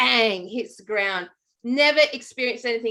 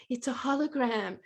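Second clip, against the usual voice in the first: "It's a hologram." said softly but voiced; quiet voiced echo of "It's a hologram."; 7 dB quieter than usual. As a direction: repeat "It's a hologram." in a hushed voice. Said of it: "this little tiny voice leaned in and went".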